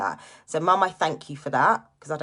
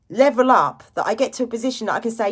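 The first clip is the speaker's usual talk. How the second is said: ordinary delivery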